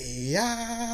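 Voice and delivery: put-on high, drawn-out old-man jeer; mock old-man yeah; old man's taunt. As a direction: sneer it like a defiant old-timer. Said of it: "they're like".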